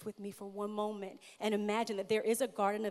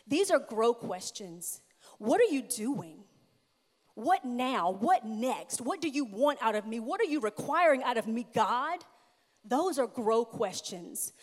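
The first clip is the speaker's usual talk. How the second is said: passionate preaching; 2 semitones higher, 6 dB louder than usual. Acted. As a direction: hammer each question home to the congregation. Preaching at full force.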